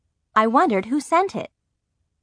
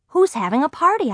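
high, eager wondering to oneself; light, higher curious thought; 8 semitones higher than usual. A narrator's reading. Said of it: "I thought".

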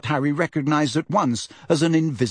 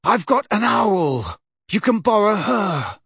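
groaning; 7 semitones higher than usual. Acted. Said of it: "Harry groaned".

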